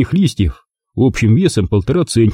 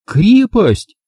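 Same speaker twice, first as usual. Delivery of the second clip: exclaiming; 11 semitones higher than usual. A narrator's reading. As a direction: burst out with the idea. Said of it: "воскликнула". (радостно воскликнула)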